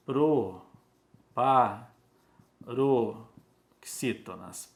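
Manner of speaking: PROPAROXÍTONAS in separate, stretched syllables; very slow "proparoxítonas", pausing between syllables; slow dictation. Shown as a demonstration. Teacher writing the term for the class, slow dictation.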